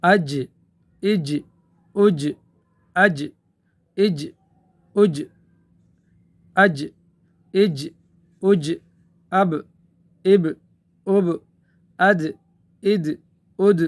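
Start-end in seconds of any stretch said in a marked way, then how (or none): 0.0-8.7 اج with the final ج jolted and echoed; qalqalah on aj. Shown as a demonstration. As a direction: shake the makhraj of ج in اج with a bounce. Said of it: "مخرج میں حرکت دے". (اج میں جیم کا قلقلہ)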